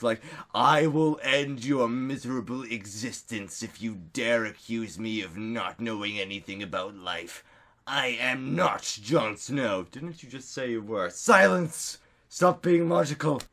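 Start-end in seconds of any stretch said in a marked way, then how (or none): 0.5-9.9 disdainful threat. Acted. Threatening whoever accuses them of ignorance, disdainful. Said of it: "like, disdainful look". 11.1-13.4 higher-pitched mock command; commanding outburst. Silencing someone who is being logical, mock-commanding. Acted retort cutting off an objection.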